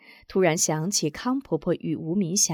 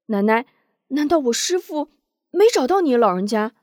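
higher, urgent question; eager young man; 6 semitones higher, 6 dB louder than usual. A narrator's reading. Wu Zhong presses Granny, lightly voiced youth. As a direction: step forward and ask quickly. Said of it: "连忙上前问".

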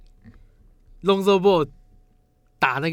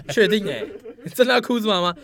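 high squealing laughter; laughing; 11 semitones higher, 9 dB louder than usual. Real, not acted. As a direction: squeal through the words. Laughing high excited plea.

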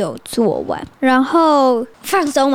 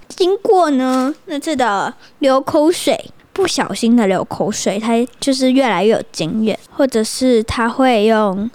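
usual speaking voice

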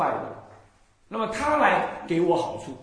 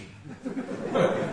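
short laugh falling away; amused laugh; 5 semitones higher than usual. Real, not acted. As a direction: laugh at the caller's confusion. Laughs after the phone story. (讲完电话故事后忍不住笑)

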